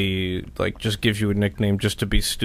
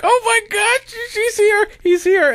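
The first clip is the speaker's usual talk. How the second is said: very high-pitched imitation of a thrilled squeal; excited surprise, imitated; 4 dB louder than usual. Imitating Cindy spotting Eric at her party, excited surprise. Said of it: "like, really surprised".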